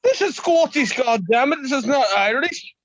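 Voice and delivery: high-pitched burst of indignation; indignant correction. Real, not acted. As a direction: set them straight, loud and offended. Indignantly correcting an accent mix-up.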